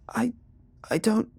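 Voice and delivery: high, loud, broken-off stutter; panicked stammer. A narrator's reading. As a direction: stammer helplessly in fear. Frightened man stammers under threat.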